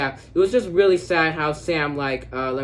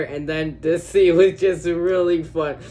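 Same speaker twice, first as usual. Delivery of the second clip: laughing; 4 semitones higher, 7 dB louder, slower than usual. Real, not acted. Laughs while gushing about scene.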